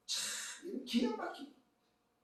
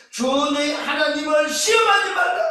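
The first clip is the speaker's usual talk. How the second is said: high, emphatic scriptural declaration; forceful command; 11 semitones higher, 11 dB louder than usual. Acted. Jesus answering the tempter, forceful command. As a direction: proclaim it as a stern command.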